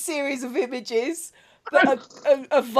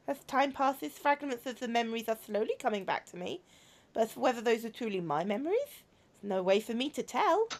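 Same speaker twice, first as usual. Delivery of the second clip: put-on subdued robot girl remembering; pensive robot girl; 9 dB quieter than usual. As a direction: speak quietly, unsure of your memories. Voices a robot girl in game.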